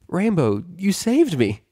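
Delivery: high, bright relieved shout of thanks; relieved cry. Acted. Twilight thanks Rainbow for saving her, high relieved cry.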